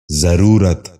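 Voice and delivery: ضرورت starting with "za", "za-roo-rat"; correct pronunciation. Shown as a demonstration. Teacher giving viewers the model, correct pronunciation. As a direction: say ضرورت as zaroorat. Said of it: "دوبارہ سنیے".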